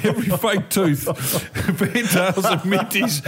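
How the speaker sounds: laughing as the list builds; laughing accusation. Real, not acted. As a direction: tease them through real laughs. Teasing accusation while laughing.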